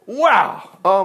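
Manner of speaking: ordinary delivery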